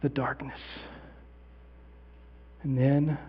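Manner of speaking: barely voiced wonder in the dark; hushed, from the grave. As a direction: leave long silences between faint words. Acting out lying dead in darkness.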